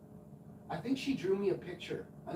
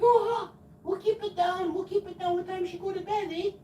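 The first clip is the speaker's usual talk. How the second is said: very high, loud put-on women's falsetto; hammed-up falsetto reply; mock neighbour women; 7 dB louder than usual. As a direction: squeal back like silly hot-tub flirts. Voices the women answering him.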